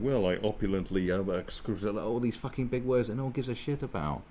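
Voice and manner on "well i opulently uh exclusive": pompous wordsmith. Retold or imitated. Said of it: "tirade of words going".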